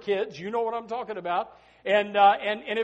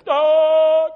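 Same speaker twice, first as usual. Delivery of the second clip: imitating Dad's long, loud holler; drawn-out shouted name call; foghorn yell; 9 semitones higher, 14 dB louder than usual. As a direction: bellow it out like a foghorn. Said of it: "it sounded like a foghorn".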